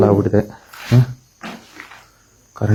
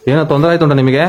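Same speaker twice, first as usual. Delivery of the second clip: higher-pitched call into the dark; raised questioning call; 4 semitones higher, 4 dB louder than usual. Real, not acted. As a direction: call out to an unseen presence. Calls out to unseen presence.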